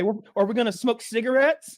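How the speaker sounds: high, loud timid question; nervous naive voice. Retold or imitated. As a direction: play the clueless new guy. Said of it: "And I'd be like".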